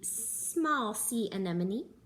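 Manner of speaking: plain reading voice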